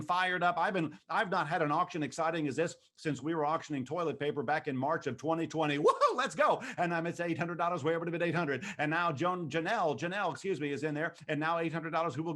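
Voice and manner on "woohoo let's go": high, loud woohoo; excited whoop. Real, not acted. Auctioneer whoops as the bidding climbs, thrilled. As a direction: whoop with delight at the bid. Auctioneer cheering a rising bid.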